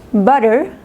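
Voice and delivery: "Butter" with T softened to a tap; American "Butter", like bu-der, rising end; American flapped T. Shown as a demonstration. Teacher showing American English, flapped T in "Butter". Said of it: "inglés americano".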